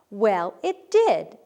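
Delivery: slow, loud emphatic exclamation; exclaimed. Shown as a demonstration. Teacher reading an example sentence, emphatic exclamation. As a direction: land it with a punch of excitement.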